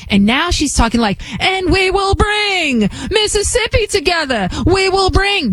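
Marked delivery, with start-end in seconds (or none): 1.4-5.5 much higher, hammed-up preacher voice calling out; mock Southern Baptist preacher. Mocking a politician, hammed-up Southern preacher shout. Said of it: "Now she's become a Southern Baptist preacher".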